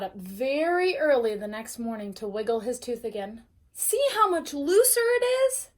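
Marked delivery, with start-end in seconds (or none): 3.7-5.7 put-on high, loud, excited kid voice; proud little boy showing off; eager child. Arthur excited to parents.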